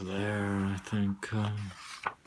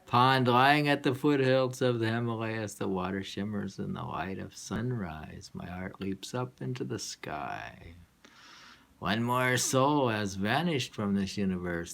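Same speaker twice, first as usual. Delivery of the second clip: louder, declaiming poem reading; poetry reading; 4 semitones higher, 7 dB louder than usual. Acted. Reader starting the poem again for a friend, declaiming. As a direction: recite the verse boldly.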